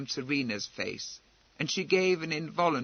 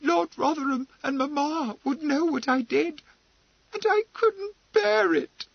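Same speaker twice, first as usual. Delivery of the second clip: panicked pleading; 13 semitones higher than usual. A narrator's reading.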